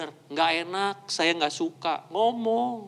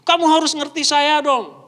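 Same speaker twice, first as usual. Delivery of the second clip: higher, louder put-on demand; mock demanding; 5 semitones higher, 5 dB louder than usual. Person demanding to be understood by others, mock demanding. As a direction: insist like someone who wants understanding. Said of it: "Jangan mau di ngertiin".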